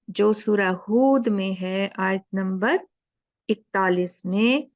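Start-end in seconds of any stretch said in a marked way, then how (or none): none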